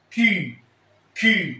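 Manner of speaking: French letter name كيو said clearly. Shown as a demonstration. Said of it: "عندي حرف اسمه".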